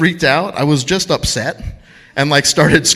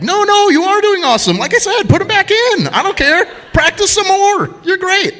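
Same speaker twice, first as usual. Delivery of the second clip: hammed-up tough guy pitched much higher and louder; mock tough guy; 4 dB louder than usual. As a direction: fake bravado to hide the pain. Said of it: "I got all tough again".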